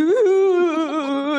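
put-on a little kid's dazed ooh; small child's groggy coo; mimicking toddler.

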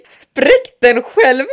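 slow, loud retold instruction; emphatic quoted order. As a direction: stretch each word out loudly in disbelief. Retelling midwives' shocking order.

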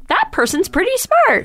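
high, surprised approval. Retold or imitated. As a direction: pleasantly caught off guard. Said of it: "I'm always like".

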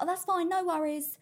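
high, light customer-service reassurance; imitating own easygoing work voice; breezy phone voice. Acting own breezy phone manner.

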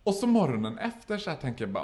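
ordinary talk, nothing marked

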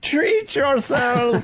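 mock voice. Joking mock voice.

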